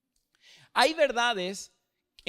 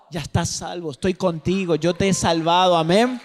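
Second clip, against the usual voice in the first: swelling louder and louder to a ringing amen; building preaching; 3 semitones lower, 8 dB louder than usual. Acted. Pastor declaring God's salvation to the church, crescendo. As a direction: build it up into a triumphant shout.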